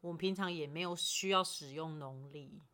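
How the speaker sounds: usual speaking voice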